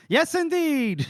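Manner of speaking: high-pitched, hammed-up assent; eager agreement. Prisoner eagerly agrees, acted.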